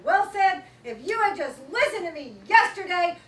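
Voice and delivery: loud exasperated scolding; scolding mom. Retold or imitated. Mom losing patience with her son, shouting. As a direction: scold the boy at full volume.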